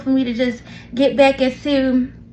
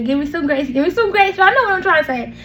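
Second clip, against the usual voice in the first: ordinary talk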